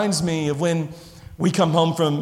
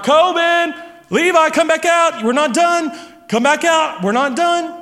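raised, higher voice calling across the yard; dad calling his sons in from the driveway; calling the kids back; 8 semitones higher, 3 dB louder than usual. Retold or imitated. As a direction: call the boys back out to the car. Dad calling boys back outside.

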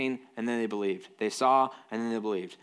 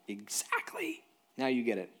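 voicing God; 5 dB quieter than usual.

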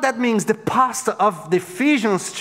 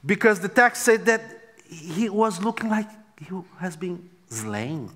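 ordinary delivery

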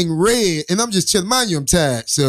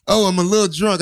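imitating tipsy driver; 3 semitones higher than usual. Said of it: "She was like".

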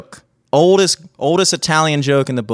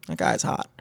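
softer whispered remark about a guy; whispered aside; 5 semitones lower, 10 dB quieter than usual. Retold or imitated. Checking out a guy, a whispered remark. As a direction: breathe it as a secret thought. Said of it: "I'm like".

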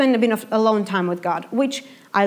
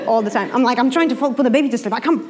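ordinary talk

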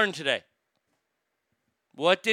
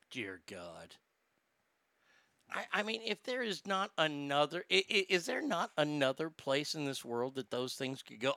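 very soft, low despairing mutter; dismayed mutter; 4 semitones lower, 11 dB quieter than usual. Real, not acted. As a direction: mutter it under your breath in dread.

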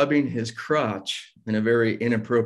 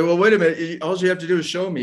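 higher and louder, rushing the words out; urgent pleading; 7 semitones higher, 3 dB louder, much faster than usual. Retold or imitated. Fired worker pleading with the manager, rushed and higher. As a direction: beg him fast to give you a chance.

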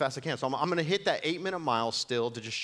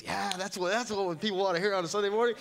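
high, sarcastic put-on voice, lightly hammed; a mock bright pitch as if selling it; sarcastic mock offer; 9 semitones higher than usual. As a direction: toss it out as a cheeky joke to God. Mocking his own idea to God.

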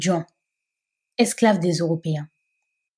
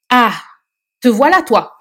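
loud, higher call across the crowd; 4 semitones higher, 7 dB louder than usual. Acted. Mame Sophie calling out to Ndeye Touti, loud call. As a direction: hail her loudly over the noise.

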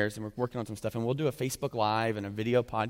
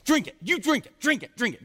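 high, loud barked order; forceful command; 11 semitones higher, 3 dB louder than usual. Retold or imitated. Moses ordering the Israelites to drink, loud high command.

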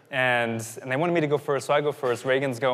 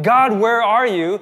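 loud, high, drawn-out plea; panicked calling out; 8 semitones higher, 9 dB louder than usual. Acted. Preacher voicing people who think they'll die, loud desperate call. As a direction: cry out to God in acted desperation.

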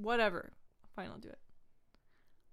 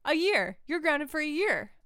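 stern mom; 4 semitones lower, 6 dB louder than usual. Acted. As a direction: play the angry mother. S1 plays the mother.

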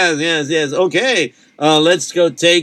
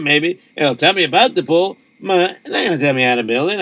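plain reading voice

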